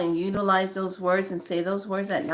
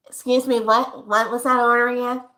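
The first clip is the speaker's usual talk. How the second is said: drive-thru worker; 3 semitones higher, 5 dB louder than usual. Acted. Drive-thru worker asking the customer to repeat the order, louder. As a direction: call back through the speaker, confused. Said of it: "Perfect example".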